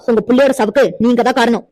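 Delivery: usual speaking voice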